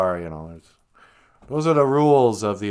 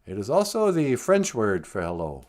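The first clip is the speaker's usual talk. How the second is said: ordinary talk, nothing marked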